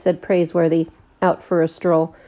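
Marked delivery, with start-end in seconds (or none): none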